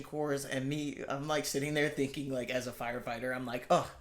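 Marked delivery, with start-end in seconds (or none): 3.7-3.9 laughing while talking, getting louder. Real, not acted. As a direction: giggle through the words. Laughing at fire hazard thought.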